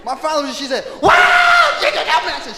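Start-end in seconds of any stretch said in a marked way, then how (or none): 1.0-2.3 very high, loud mock wail; grandmother's funeral yell. Grandmother at father's funeral, imitated wailing yell. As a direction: let out a piercing, hammed-up cry to Jesus. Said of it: "My grandmother could yell like that".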